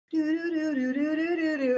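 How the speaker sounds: high, sing-song "do-do-do"; eerie jingle imitation. Teller marking her extrasensory moment, high eerie sing-song. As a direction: mimic spooky signature-tune notes.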